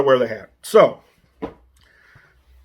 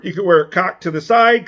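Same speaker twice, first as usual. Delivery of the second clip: normal talking voice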